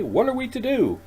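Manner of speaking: calling out high and loud after someone walking away. A narrator's reading. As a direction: raise the voice to reach him. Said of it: "asks Roger loudly".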